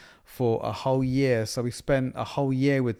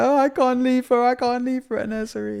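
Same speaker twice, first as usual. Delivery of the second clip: higher, louder, hammed-up wailing; distraught wail; 12 semitones higher, 5 dB louder than usual. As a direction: wail like a dad who can't let go. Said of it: "I was distraught".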